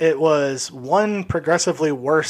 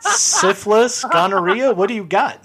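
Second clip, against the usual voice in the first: mock innocent questions; 5 semitones higher, 4 dB louder, slower than usual. Teen sarcastically answers offer.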